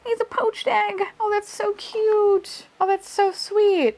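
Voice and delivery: high squeaky cooing; excited squeal. Real, not acted. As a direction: squeal over something cute. Surprise reveal, squealing.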